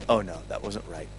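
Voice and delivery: a quiet inner oh no; muttered aside. Retold or imitated. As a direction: mutter it to yourself in dismay. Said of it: "And I went".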